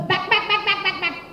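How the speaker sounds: high, fading bird calls; bird alarm call. Acted. Monkey hears the bird's high shooing calls. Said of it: "When he hears".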